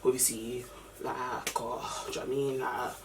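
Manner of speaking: low, very soft hesitant mumbling; waffling. Retold or imitated. Guy questioned about the collapsed girl, quiet low waffling. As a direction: trail through fillers quietly, getting nowhere. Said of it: "Like, he was waffling".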